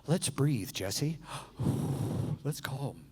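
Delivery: soft, calming voice; soothing. Retold or imitated. Talking Jesse down from raging bull, soothing. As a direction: talk him down gently with a slow sigh.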